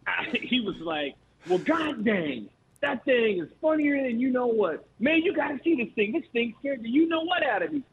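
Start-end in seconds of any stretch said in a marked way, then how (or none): 1.5-7.8 amused coach voice. Retold or imitated. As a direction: play the coach cracking up at the prank. Coach retold finding prank funny.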